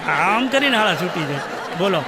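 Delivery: usual speaking voice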